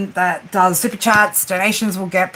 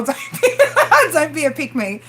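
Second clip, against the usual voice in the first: laughing through the words; 7 semitones higher than usual. Real, not acted. Repeating her joke to the chat, laughing. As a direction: giggle while repeating it.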